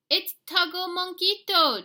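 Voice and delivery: high, loud cheer. A narrator's reading. Dogs cheering the tug of war, high loud cheer. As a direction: cheer it together. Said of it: "cheered the Chimichangos".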